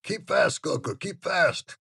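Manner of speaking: loud, slow shout of a servant calling off a dog. A narrator's reading. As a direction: bellow it out, dragging each word.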